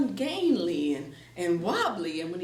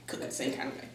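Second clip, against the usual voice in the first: ordinary delivery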